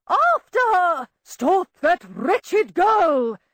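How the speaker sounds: loud, high, slow commands; commanding shout. A narrator's reading. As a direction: order her to stop. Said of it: "Mycroft's commanding voice".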